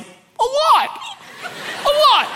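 extremely high little-brother squeal; hammed-up panicky squeak; distraught confession. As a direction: wail it out in a tiny high voice. Brother's acted anguished confession.

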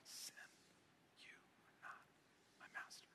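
whispered under the breath, so only a neighbour hears; soft whisper so the person beside you can hear. Shown as a demonstration. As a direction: barely breathe the words. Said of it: "like a whisper".

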